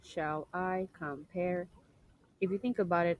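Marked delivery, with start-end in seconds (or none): 0.0-1.7 slow "Shall I compare", each syllable apart, alternating weak and stressed; iambic down-up reading. Shown as a demonstration. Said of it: "I'm going down, up, down, up".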